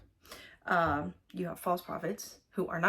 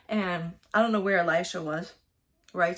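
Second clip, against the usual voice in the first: ordinary talk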